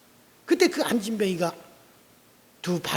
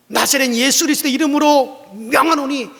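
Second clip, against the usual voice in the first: much louder ringing command; commanding shout; 4 semitones higher, 8 dB louder than usual. Acted. Pastor commanding the lame man to rise, loud. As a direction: proclaim it with full authority.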